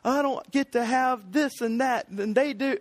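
self-pitying whine, put on; mock whiny. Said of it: "so whiny and self-pitying".

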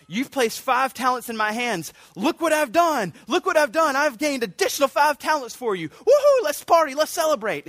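rising to an overjoyed high, loud whoop; excited servant. Retold or imitated. Servant reporting to his master, overjoyed and whooping. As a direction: burst with pride and celebrate. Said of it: "excitement that he has".

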